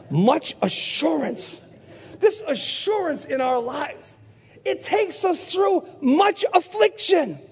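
slow, drawn-out proclamation rising high; emphatic preaching. Real, not acted. Preacher declaring assurance through affliction, emphatic preaching. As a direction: stretch each phrase out for the congregation.

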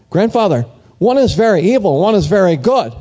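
put-on a small boy pleading with his grandfather; anxious little boy. Boy worriedly asks grandfather.

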